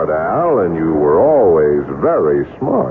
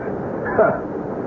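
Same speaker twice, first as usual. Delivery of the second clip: breathy mocking chuckle; 13 semitones higher, 7 dB quieter than usual. Gangster laughs at his question.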